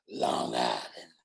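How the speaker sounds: very low, slow and heavy, like a bass; mock deep voice. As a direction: imitate her voice dropping deep into a growl. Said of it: "she said like this".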